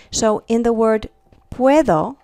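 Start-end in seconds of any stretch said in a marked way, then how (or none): 1.5-2.2 puedo with the D softened to TH. Shown as a demonstration. Teacher giving students an example word, D like TH. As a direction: say puedo as pweh-tho, lifting at the end. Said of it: "this D here is more pronounced".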